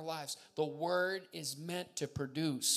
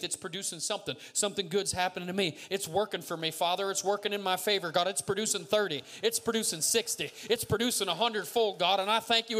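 rising, full-voiced prayer of faith; fervent declaration; 2 semitones higher, 7 dB louder than usual. Real, not acted. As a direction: drive each declaration harder toward a shout. Prayer declaration building to climax.

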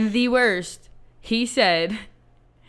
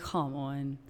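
quiet plea; 6 semitones lower, 12 dB quieter than usual. Retold or imitated. Pleading quietly for ID.

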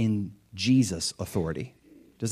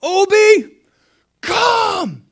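put-on very high, loud little boy's command; a small boy calling out the dog, trying to sound big; 10 dB louder, much slower than usual. Little son commanding the family dog in his big boy voice. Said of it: "in his big boy voice".

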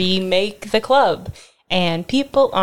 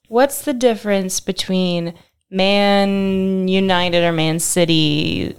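ordinary delivery

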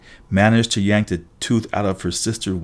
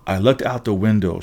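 ordinary delivery, nothing marked

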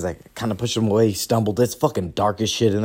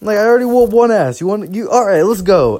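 high, loud exasperated rant; higher, louder retold protest to his buddies; mock angry outburst; 11 semitones higher, 6 dB louder than usual. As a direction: act out being fed up and ready to fight. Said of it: "I'm like".

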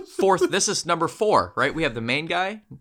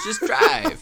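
higher, laughing mock of the scammer's "Just drive."; mocking accented quote; 4 dB louder than usual. Repeating the scammer's answer for the friend, with a high laughing mockery. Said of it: "That's his response".

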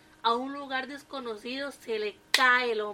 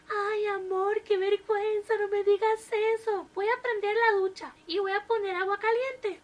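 put-on high, coy old wife; the wife blushes at his boast; raised wife voice, shy; bashful wife; 8 semitones higher than usual. A narrator's reading.

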